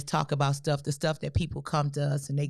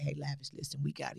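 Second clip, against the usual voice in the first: much softer, confiding retelling; hushed retold call; 3 semitones lower, 8 dB quieter than usual. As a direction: say it low, as if on a secret call. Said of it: "I don't know why he be whispering".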